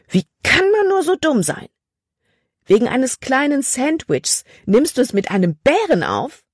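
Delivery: furious scolding. Acted. Caleb scolding Libby for risking her life, furious. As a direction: let the anger explode in disbelief. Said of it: "Sein Gesicht war zornerfüllt".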